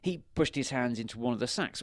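ordinary delivery, nothing marked

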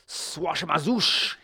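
slow, high-pitched incantation; cried magic word. A narrator's reading. Wizard at his crystal ball, crying a magic word. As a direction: cry it out drawn-out, like a spell. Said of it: "he cried".

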